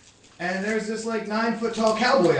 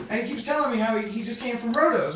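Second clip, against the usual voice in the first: ordinary talk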